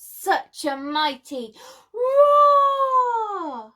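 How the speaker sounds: drawn-out dramatic roar; mighty roar. A narrator's reading. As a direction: bellow it out like a dinosaur. Story of a dinosaur's mighty roar.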